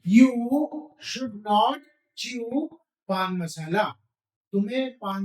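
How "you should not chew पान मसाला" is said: very slow, each word drawn out; slow dictation. Shown as a demonstration. Teacher dictating the sentence to the class, very slowly. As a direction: spell it out slowly for students to repeat. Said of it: "बोल करके बताइए".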